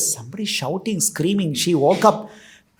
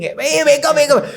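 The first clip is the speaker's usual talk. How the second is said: higher-pitched urgent call to a sleeper; urgent waking call; 6 semitones higher than usual. Acted. Someone waking a sleeper after an accident, urgent call. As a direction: rouse someone quickly, pitch raised.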